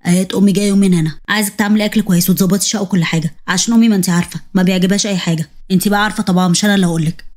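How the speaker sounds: low-voiced commanding husband; husband's voice. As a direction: play the demanding husband, voice dropped low. Husband Ahmed voiced by narrator.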